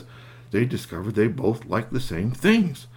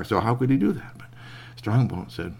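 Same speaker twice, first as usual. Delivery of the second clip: ordinary delivery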